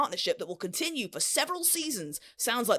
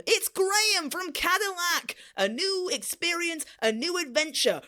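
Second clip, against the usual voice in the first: gameshow announcement; 6 semitones higher than usual. Acted. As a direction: announce the winner like a dating show host. Host announces the match.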